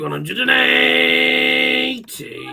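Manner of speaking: long drawn-out caller shout; darts caller voice. Pretend. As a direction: stretch it out like a darts MC. Mimics darts caller announcing 180.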